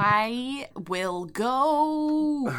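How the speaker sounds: very slow, drawn-out "I will go"; hammed-up dignified departure; mock solemn exit. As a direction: stretch each word in feigned shame. Mock exit after being called racist.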